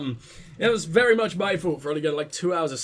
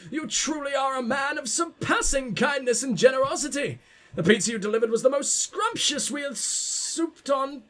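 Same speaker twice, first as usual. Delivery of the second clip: pompous gentleman voice; 7 semitones higher than usual. Acted. Reads letter as pompous gentleman.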